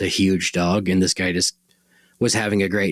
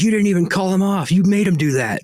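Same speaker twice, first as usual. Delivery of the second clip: high-pitched, mildly raised retold yelling; imitated screaming; 11 semitones higher, 4 dB louder than usual. Bitten biker screams at the dog's owner, imitated screaming. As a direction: mimic an accusing outburst, much higher. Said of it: "he was, like, screaming at me".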